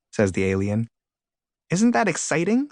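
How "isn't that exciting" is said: high, excited squeal of a question. A narrator's reading. Newly found egg talking to the alien, excited. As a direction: bubble over, pitched way up. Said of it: "shaking with excitement".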